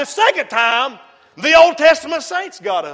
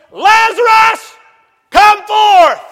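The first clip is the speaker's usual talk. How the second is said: loud, slow commanding call on "Lazarus"; 6 semitones higher, 6 dB louder, slower than usual. Acted. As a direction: call the dead man out of the tomb. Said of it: "he said with a loud voice".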